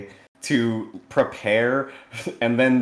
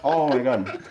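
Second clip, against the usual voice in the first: hammed-up flustered apology, higher and louder; mock embarrassment; 4 semitones higher, 4 dB louder than usual. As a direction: play fake shock at your own slide. Mock embarrassment over image.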